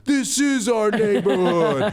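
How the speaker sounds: high, stretched-out mock holler; defiant yell back. [name] answers the yelling neighbor, defiant drawn-out holler. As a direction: imitate a drunk teen hollering back proudly.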